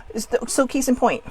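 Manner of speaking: ordinary talk, nothing marked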